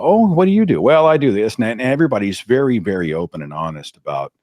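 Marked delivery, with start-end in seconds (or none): 0.0-1.5 higher, louder chatty exchange; relatives at dinner. Retold or imitated. Family trading job answers at dinner, higher and louder. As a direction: mimic the table chit-chat.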